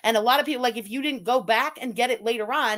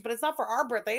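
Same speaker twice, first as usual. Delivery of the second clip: ordinary talk, nothing marked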